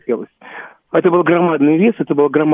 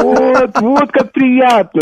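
touched; 7 semitones higher, 3 dB louder, much slower than usual. Retold or imitated. Retelling his reply to fans.

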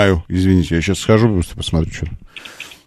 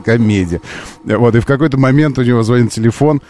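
ordinary delivery

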